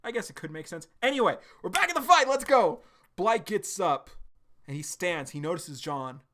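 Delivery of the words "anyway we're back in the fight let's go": much higher, louder and fast; real excitement, almost shouting; hyped. Real, not acted. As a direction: fire yourself up to jump back in. Hyped return to the action.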